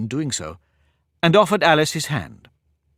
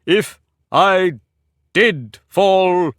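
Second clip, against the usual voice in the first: higher, louder, pompously solemn; solemn and grand; 6 semitones higher, 6 dB louder, much slower than usual. A narrator's reading. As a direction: pause as if announcing something grand. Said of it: "looked so solemn and grand".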